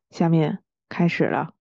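ordinary talk, nothing marked

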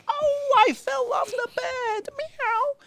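very high, meowing kitten cry; squeaky kitten voice. Pretend. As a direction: whimper for help as kitten. Kitten toy fell off bed.

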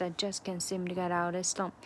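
ordinary talk, nothing marked

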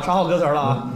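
ordinary delivery

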